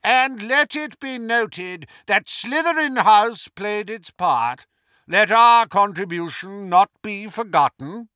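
high, loud, reedy call. A narrator's reading. Portrait claiming credit for Slytherin House, high reedy call. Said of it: "called in his high, reedy voice".

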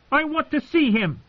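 very high-pitched, stubborn demand; insistent boy. A narrator's reading. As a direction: insist harder, voice shooting up high.

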